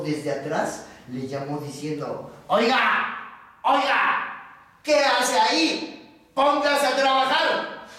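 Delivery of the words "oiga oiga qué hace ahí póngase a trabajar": raised, rude boss's call; horrible, bad-tempered voice. Foreman calling to the bear from behind, bad-tempered. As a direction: order him about, pitched up and drawn out. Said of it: "una voz horrible, de muy mal modo".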